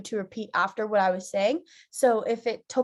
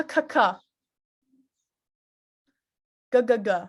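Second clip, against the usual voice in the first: each syllable spat out cleanly; crisp consonant drill; 2 semitones higher, 5 dB louder than usual. Shown as a demonstration. Teacher leading students through a consonant drill. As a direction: call the consonants for the class to echo.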